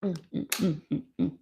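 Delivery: savouring a bite; mumbled through food; mouth full. Real, not acted. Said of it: "If it's the chicken sandwich I ate".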